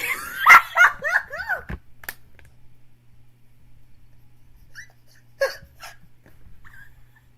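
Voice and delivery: high, loud burst of laughter; shrieking laugh. Real, not acted. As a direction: crack up helplessly. Laughing at her own impression.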